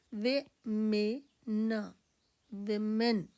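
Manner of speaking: विमेन said slowly as वे-मेन; pronouncing women. Shown as a demonstration. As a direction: spell out विमेन as वे में न, slowly. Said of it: "इसकी pronunciation Hindi में आएगी". (विमेन का उच्चारण धीरे से, वे-मेन)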